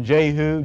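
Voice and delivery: Jehu with a long 'ay' in the first syllable; Jehu as JAY-hoo. Shown as a demonstration. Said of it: "any one of those is correct".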